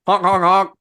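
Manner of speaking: high loud honk imitation; horn honks. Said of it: "Harpo's horn honked three times".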